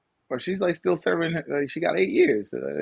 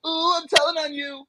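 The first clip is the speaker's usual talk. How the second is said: high whining mock child crying to tell; fake-cry like a tattling kid; whiny tattletale; 10 semitones higher than usual. Mocking the US as a tattling child, high whiny fake cry.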